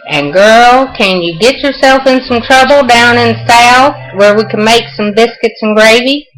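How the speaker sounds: playful Southern talk, mostly lifted in pitch; Southern accent. Shown as a demonstration. Showing viewers how we talk in the South, Southern accent. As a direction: lay on the Southern accent for show. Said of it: "that's how we talk in the South".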